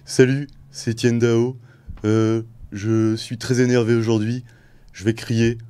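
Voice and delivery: [name] impression. Shown as a demonstration. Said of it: "il faut faire du monocorde".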